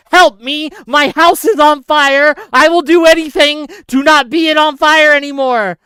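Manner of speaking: loud, high imitated cry for help; hammed-up begging at full volume; desperate plea. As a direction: shout as the desperate homeowner. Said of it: "he's like".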